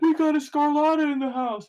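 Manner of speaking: high put-on old man, announcing; old-timer crowing to the court. Seventy-year-old man announces the famous family name, high old voice. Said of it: "He's like a seventy-year-old man".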